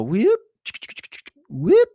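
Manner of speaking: quick upward-swooping whoop; rising whoop. Shown as a demonstration. Streamer showing a price blast-off with rising whoops. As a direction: flick the pitch sharply upward. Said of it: "do one of those".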